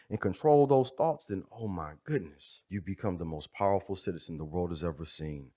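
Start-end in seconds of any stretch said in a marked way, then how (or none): 1.3-5.5 hushed build. Real, not acted. Builds softly to tagline.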